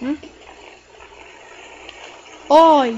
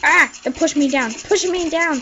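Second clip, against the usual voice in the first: ordinary talk, nothing marked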